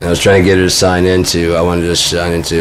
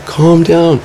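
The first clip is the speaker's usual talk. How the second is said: high-pitched upset yell, not full volume; strained, high cry climbing on the repeat; screaming; 11 semitones higher, much slower than usual. Retold or imitated. Upset adult child telling mom to calm down, screaming. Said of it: "I'm fucking screaming".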